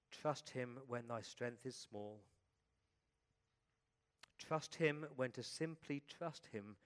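slow, measured prayer with long pauses; reverent, unhurried poem; prayerful poem reading. Acted. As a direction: read line by line for others to echo.

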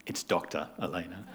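lightly voiced haughty wife; mock correction. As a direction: correct him sweetly but pointedly. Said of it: "She goes".

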